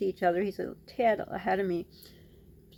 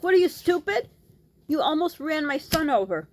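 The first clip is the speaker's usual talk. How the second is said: high, loud accusing yell; angry yell; 8 semitones higher, 5 dB louder than usual. Retold or imitated. Confronting the driver who nearly ran her son over, angry yell. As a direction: demand an answer from the driver.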